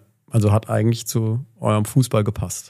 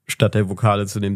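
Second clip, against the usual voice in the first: normal talking voice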